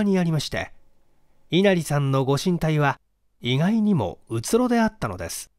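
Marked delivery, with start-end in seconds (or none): none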